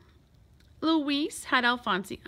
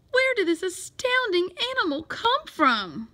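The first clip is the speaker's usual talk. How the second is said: high-pitched amazement; astonished cry; 8 semitones higher, 3 dB louder than usual. A narrator's reading. Librarian seeing the giant tadpole, astonished cry. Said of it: "cried Miss Severs".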